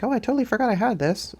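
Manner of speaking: high, quick reaction quote; mock surprised discovery. Vlogger imitating someone unpacking forgotten stuff, mock surprised discovery. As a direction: act out a sudden happy rediscovery. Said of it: "you're like".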